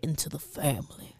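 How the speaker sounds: breathy hushed whisper. Pretend. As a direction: whisper it like a secret. Whispered echo of own phrase.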